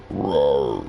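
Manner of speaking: low, growled demon roar. Pretend. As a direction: let out a deep demon roar. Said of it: "Instead of sounding like a demon".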